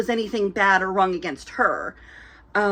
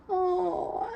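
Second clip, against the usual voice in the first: cooing aw; 10 semitones higher, 4 dB quieter than usual. Retold or imitated. People melting at a baby.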